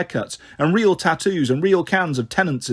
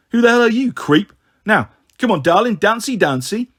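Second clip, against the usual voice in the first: higher, aggressive lad turning mock-coaxing; lightly voiced skinhead; 6 semitones higher, 4 dB louder than usual. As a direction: challenge him, then wheedle the girl. Skinhead challenges Dan, then coaxes.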